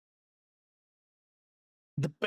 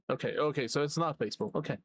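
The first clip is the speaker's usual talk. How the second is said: ordinary delivery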